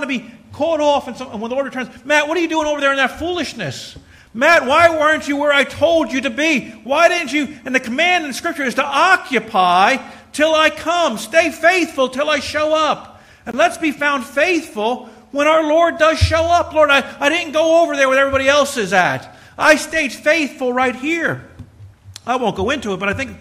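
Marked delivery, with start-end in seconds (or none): 2.0-7.6 higher, louder scolding voice; the Lord calling him out; the Lord scolding Matt for being where he shouldn't be; stern Lord's rebuke. Pretend. 7.6-16.7 higher, louder, slow preaching; full-force sermon climax; fiery preaching. Acted. 16.7-21.5 higher, louder earnest plea; a faithful servant telling the Lord he stayed put; pleading servant. Pretend. Preacher answers the Lord.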